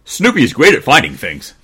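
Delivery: keeper crying out. Acted. Keeper boasting of the dog to Daddy, loud and high. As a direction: praise the dog loudly. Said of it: "cried the keeper".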